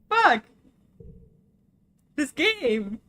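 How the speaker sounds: anguished outburst. Real, not acted. Player crying out at a heartbreaking game. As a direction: yell at the game in grief.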